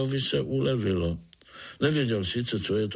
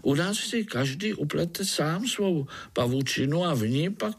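higher-pitched little spider; lightly voiced spider, pitched up; explaining spider; 6 semitones higher than usual. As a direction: explain earnestly in a raised voice. Pája answers the overseer.

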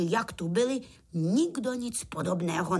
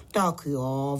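sighed; 7 semitones lower than usual. A narrator's reading. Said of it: "vzdychl Pytlík za chvíli".

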